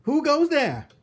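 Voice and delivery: very high, loud alarmed shout; cook's shout. Acted. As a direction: bellow it out like a startled cook. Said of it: "and shouted".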